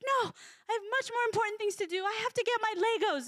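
put-on high, fast, brushing-off kid voice; whiny kid. Parent quoting a child who refuses a drink, high and fast. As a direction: play the busy child who can't be bothered.

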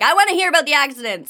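high, loud eager demand; fast, eager pleading; eager listener. Pretend. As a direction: blurt it out like a hooked listener. Listener voiced wanting the story.

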